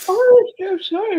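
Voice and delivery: talking through a big yawn. Real, not acted. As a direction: say it mid-yawn. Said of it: "I'm yawning on my own live here".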